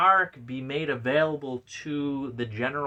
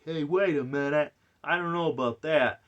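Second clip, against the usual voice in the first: higher, louder sceptical protest; mock objection; 5 semitones higher, 5 dB louder than usual. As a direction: object in a lightly put-on voice. Mimics own objection to author.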